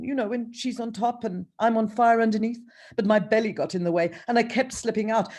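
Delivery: sultan voice. Acted.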